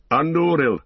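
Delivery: high, loud cry of the sword's name; battle cry. A narrator's reading. Aragorn charging the wild men, a loud battle cry. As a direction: shout it as the blade is drawn. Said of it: "cried Aragorn".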